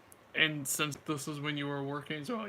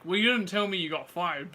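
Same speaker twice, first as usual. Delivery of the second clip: ordinary talk, nothing marked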